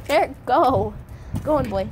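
ordinary talk, nothing marked

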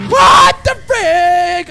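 loud, high, drawn-out shout of shock; shocked outburst. Real, not acted. Player startled at the start, shocked shout. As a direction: blurt it loudly, stretching the words out.

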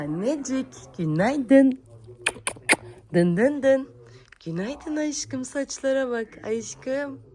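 high, loud, slow wake-up greeting. Real, not acted. Mother greeting her baby loudly in the morning. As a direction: brighten her morning with a song-like call.

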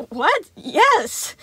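overly loud, forced cheer; too-loud laugh. A narrator's reading. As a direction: blurt it out with fake brightness. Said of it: "Sun laughed, a bit too loudly".

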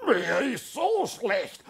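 put-on sick old lady; frail resident. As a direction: complain weakly about nausea. Resident complaining.

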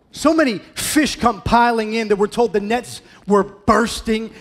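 high, loud, driving sermon delivery; full-throttle pulpit storytelling; impassioned preaching. Acted. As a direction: preach it at full force, pitched high and ringing out.

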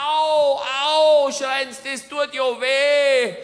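hammed-up yelp from clapping; a villager wailing that it hurts; mock cry of pain. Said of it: "schreien die Indianer".

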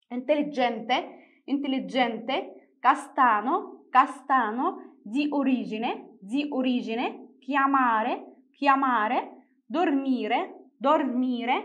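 Italian vocabulary drill with rising list tune; word-list demonstration. Shown as a demonstration. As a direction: say each word singly and clearly, lifting the last syllable. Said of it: "نبدأ نقرأ الكلمات".

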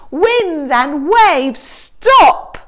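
Jesus commanding the storm, powerful. Acted. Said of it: "his powerful words".

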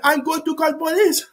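high, lightly voiced threat; a lightly voiced angry owner; imitating the guy's threat. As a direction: play the guy calling police. Voicing the guy threatening.

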